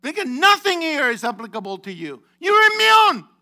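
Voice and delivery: loud, high outburst rising to a yell; shouted rebuke. Real, not acted. Preacher rebuking those who think themselves immune, shouting. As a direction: build to a piercing shout on the last words.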